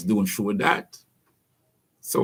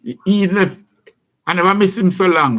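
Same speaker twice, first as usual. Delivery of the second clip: normal talking voice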